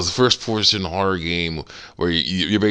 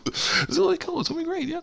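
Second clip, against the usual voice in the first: very high falsetto list of temptations; coaxing walrus cook. Retold or imitated. Walrus tempting Crash with bath oils, coaxing falsetto. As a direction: coax in a squeaky comic villain voice. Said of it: "doing the same thing with this walrus".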